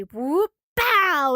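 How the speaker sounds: rising "whoop" into a loud "bow"; imitated crash noise. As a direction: act out a tumble with cartoon sound effects.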